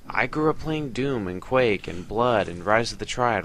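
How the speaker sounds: normal talking voice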